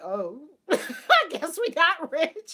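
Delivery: drawn-out, loud line through giggles; laughing retelling. Retold or imitated. Child's conclusion about money retold, laughing. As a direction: stretch it out while laughing.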